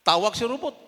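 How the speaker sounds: ordinary delivery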